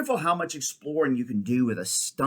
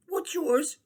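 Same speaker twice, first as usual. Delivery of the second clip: put-on much higher curious girl; girl's voice. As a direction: toss the question back. Girl asks boy's name.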